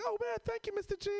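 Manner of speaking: high little first-grader voice; Ethan. Retold or imitated. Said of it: "he was like".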